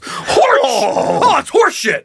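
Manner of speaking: high, neighing horse outburst; outraged talking horse; indignant horse. Acted. Horse protests at having no apples.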